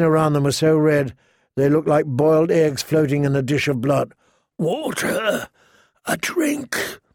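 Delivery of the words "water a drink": high, thin begging for water; a parched caged man pleading for a drink; desperate prisoner. Acted. Caged prisoner begs passing girl for water, high and pleading.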